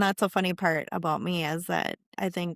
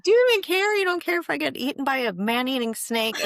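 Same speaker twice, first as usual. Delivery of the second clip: mock outrage; 7 semitones higher than usual. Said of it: "I'm like".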